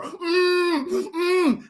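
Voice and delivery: high hammed-up muffled whimper; muffled captive cries. Kidnapped man tied in back of car, high mock muffled cries. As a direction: squeal through closed lips.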